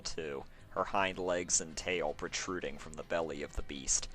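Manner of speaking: ordinary delivery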